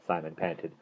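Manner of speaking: plain reading voice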